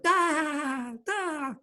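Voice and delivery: high, squeaky cartoon sponge voice; SpongeBob voice. Host showing off his SpongeBob impression. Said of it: "I practice that a lot too".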